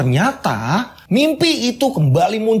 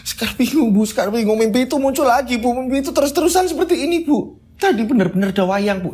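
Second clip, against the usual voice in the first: high, breathless pleading to mother; panik ngos-ngosan; 8 semitones higher than usual. Acted. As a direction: plead in rising panic, pitched high. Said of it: "Sekar ini masih ngos-ngosan". (mengadu panik, suara tinggi memelas)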